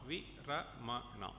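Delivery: "Viramana" said slowly, syllables even, falling; slow demo. Shown as a demonstration. Teacher showing all-short syllables, slowly. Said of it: "Then we have all short".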